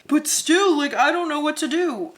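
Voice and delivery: channeled helpless person. Acted. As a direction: imitate someone stuck and protesting. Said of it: "channeling this energy of somebody who's just like".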